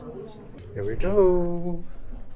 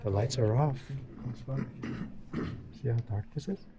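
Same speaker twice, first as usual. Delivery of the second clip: hushed; 2 semitones lower, 13 dB quieter than usual. Real, not acted. In dark cave.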